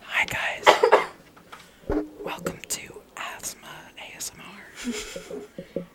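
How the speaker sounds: breathy wheezing ASMR whisper; wheezy voice. Pretend. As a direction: wheeze the words into the mic. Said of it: "It's just full-on wheezing".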